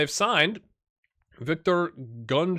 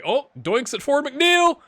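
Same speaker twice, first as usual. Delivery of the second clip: high, loud and slowed oh at the woodwork; excited exclamation; 12 semitones higher, 8 dB louder, slower than usual. Real, not acted. Gamer watching a shot hit the post, excited cry. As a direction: gasp out the near miss.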